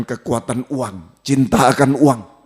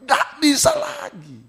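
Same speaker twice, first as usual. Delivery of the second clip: shouted warning. Real, not acted. Preacher warning of God's punishment.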